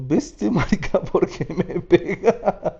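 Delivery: high, laughing mock complaint, slow at the end; laughing protest. To [name] after being slapped, laughing mock protest. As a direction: laugh through a fake-offended protest.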